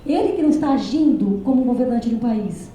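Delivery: ordinary delivery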